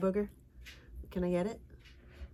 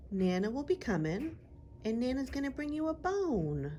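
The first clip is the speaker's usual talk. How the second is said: excited sing-song; 6 semitones higher than usual. Real, not acted. Teasing the dog.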